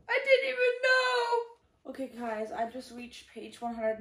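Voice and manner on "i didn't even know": much higher, louder crying cry-out; tearful shout. Real, not acted. Reader discovering trigger warnings, tearful shout. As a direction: cry it out in dismay.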